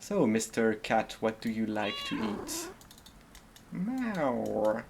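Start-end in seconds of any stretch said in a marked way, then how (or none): 3.7-4.8 a cat meow that cracks; cracking meow. Pretend. Streamer answering for the cat, voice cracking. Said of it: "Was that a voice crack?".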